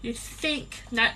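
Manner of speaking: ordinary talk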